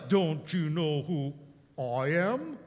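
slow, drawn-out pompous quote; mock arrogance. Mocking the arrogant man at the meeting, slow and pompous. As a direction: drag it out like a self-important big shot. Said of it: "who of any of us".

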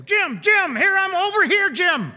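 high, loud mock call to Jim; playful, loud calling voice; mock God calling out. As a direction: ham up God beckoning him over. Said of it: "No, he didn't, didn't say that".

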